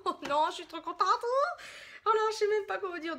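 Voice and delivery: high, laughing excitement; giddy joy. Real, not acted. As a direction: squeal that you are overjoyed. Overjoyed at the huge palettes.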